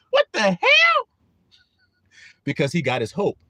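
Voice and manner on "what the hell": much higher, shocked outburst; baffled by the sudden mood swing; exclaimed what the hell; incredulous. Real, not acted. Incredulous at child's switch.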